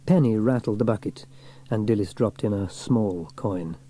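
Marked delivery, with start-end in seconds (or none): none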